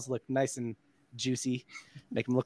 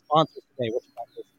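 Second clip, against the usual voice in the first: underwater; 4 dB louder, faster than usual. Real, not acted. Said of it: "you sound a little bit underwater here".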